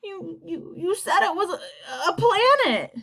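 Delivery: put-on high, broken, sobbing child's voice; crying. A narrator's reading. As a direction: stammer it through tears. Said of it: "In the middle of a crying fit".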